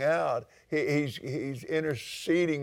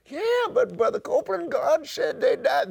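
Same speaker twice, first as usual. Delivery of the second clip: high, put-on voice of a doubter in the pews; objecting churchgoer; 12 semitones higher than usual. Preacher mimics a listener protesting to Brother Copeland, high and whiny. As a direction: play the know-it-all who butts in.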